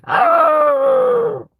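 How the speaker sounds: high, loud yelping dog howl; husky howl. Retold or imitated. Imitating his husky's voice for chat, a high yelping howl. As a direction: bark it out like a husky. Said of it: "But he goes".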